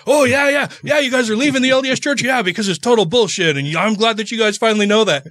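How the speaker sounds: high-pitched, eager mock cheering; imitating excited approval. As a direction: play the street preacher delightedly cheering them on. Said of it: "he's all excited initially".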